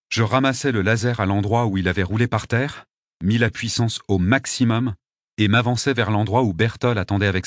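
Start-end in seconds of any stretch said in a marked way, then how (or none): none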